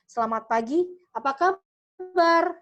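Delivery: "Selamat pagi" rising, then APA KABAR high, loud and drawn out; sing-song lift on "apa kabar", stretched slowly; high-low intonation. Shown as a demonstration. Vocal coach showing students tinggi rendah, high-low intonation. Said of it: "Nah, itu adalah intonasi".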